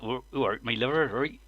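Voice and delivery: Cornish brother impression. Imitating his brother who lives in Cornwall, mock Cornish accent. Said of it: "he t-, speaks like that now".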